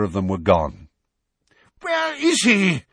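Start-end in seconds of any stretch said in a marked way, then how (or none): 1.8-2.8 much higher, loud slow yell; furious searching cry. A narrator's reading. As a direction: bellow the question in alarm. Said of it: "yelled Fudge".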